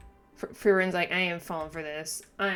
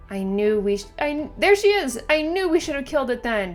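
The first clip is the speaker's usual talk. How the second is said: loud, higher bitter anger; angry outburst; 8 semitones higher, 6 dB louder than usual. Acted. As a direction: spit it out in regret. Frieren finds the demon.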